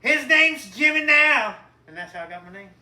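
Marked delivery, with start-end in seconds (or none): 0.0-1.6 much louder and higher, slow proud yell; Southern dad's hammed-up joyful yell; Daddy shouting. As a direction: holler it like a dad naming his newborn. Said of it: "and Daddy goes".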